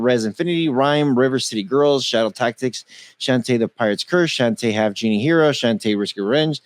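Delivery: speed-read game list; rushed roll call with small stumbles; as fast as possible. Real, not acted. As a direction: rattle off title after title, barely pausing. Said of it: "say this as fast as I can".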